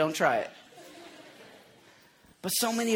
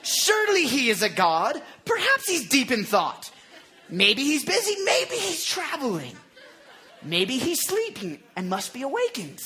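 mock-helpful speculation, pitched up; teasing, raised-voice needling; taunting; 6 semitones higher than usual. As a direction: act out a trash-talker toying with rivals. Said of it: "Elijah begins to taunt them".

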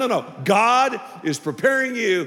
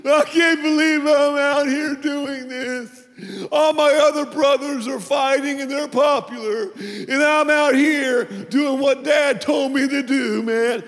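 whiny complaint; 6 semitones higher than usual. Retold or imitated. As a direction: whine it like a put-upon kid. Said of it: "David didn't whine".

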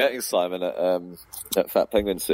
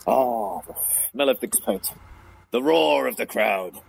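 hammed-up thespian, drawn-out and a touch high; Mark Tracy theatrical; 3 semitones higher than usual. Retelling a comic's favourite joke, hammed-up theatrical delivery. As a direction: imitate a grand old stage performer. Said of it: "he would be all theatrical".

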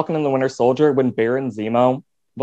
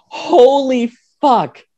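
slow, stretched-out holy fuck, pitched higher; drawn-out shock; 10 semitones higher, 4 dB louder, much slower than usual. Real, not acted. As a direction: let the disbelief drag out slowly. Shocked reaction to modern Beast.

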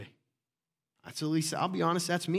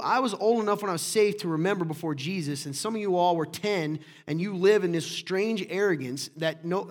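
ordinary delivery

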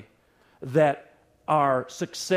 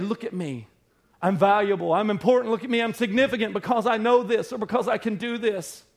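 higher, louder boastful plea; mock a needy self-promoter; hammed-up cry of look at me; mock attention-seeker; 6 semitones higher, 4 dB louder than usual. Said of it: "trying to scream at someone".

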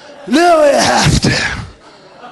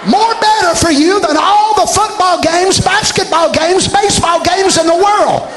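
big raised preacher voice, pushing every phrase; full-force preaching; 6 semitones higher than usual. Acted. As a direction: preach it out to the whole church.

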